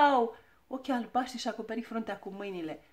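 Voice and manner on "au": loud, sudden "ow"; cry of pain. A narrator's reading. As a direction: yelp as if just whacked. Struck on forehead with ruler. (țipăt de durere)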